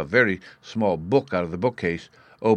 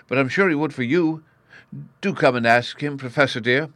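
lightly voiced young girl, higher pitch; a hint of coaxing, raised voice; pleading Sylvie; 8 semitones higher, 5 dB louder than usual. A narrator's reading. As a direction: gently urge the professor as a child would.

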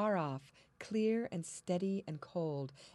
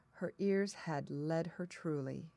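ordinary delivery

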